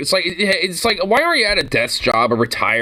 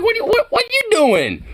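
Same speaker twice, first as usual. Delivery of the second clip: squeaky mock cop. Continued squeaky parody.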